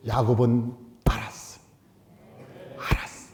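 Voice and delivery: a soft, breathy whisper as if sharing a secret. Acted. Preacher whispering to the congregation that Jacob knew.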